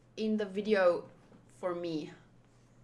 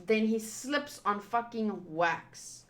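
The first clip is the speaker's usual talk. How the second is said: usual speaking voice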